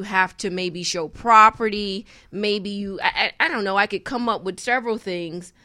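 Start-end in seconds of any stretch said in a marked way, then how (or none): none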